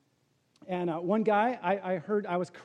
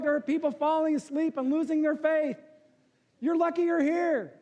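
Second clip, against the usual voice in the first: Saint Peter scolding; 7 semitones higher than usual. Retold or imitated. Peter telling the pastor off, teasing rebuke. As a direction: deliver the punchline as a teasing Peter. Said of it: "Peter looks at him and he says".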